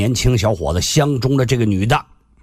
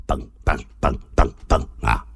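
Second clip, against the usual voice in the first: heartbeat sound; 13 semitones lower, 4 dB quieter than usual. Acted. Imitating a rhythmic heartbeat.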